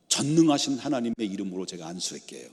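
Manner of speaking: usual speaking voice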